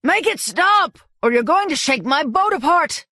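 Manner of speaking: put-on high angry yell at the girl. A narrator's reading. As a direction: shout her down in panic. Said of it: "yelled at her".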